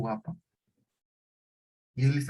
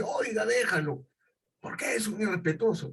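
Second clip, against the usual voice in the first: friend breaking up fight; 6 semitones higher than usual. Retold or imitated. Friend intervenes in retold fight.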